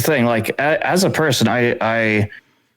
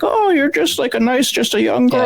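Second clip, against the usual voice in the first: much higher mock-sweet voice; condescending shop worker. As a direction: talk down to a young customer in a high put-on voice. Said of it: "the other person is like".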